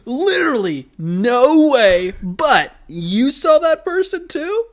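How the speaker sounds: high, loud put-on amazement; mock disbelief. Cohost pretending to be the kid playing along, hammed-up disbelief.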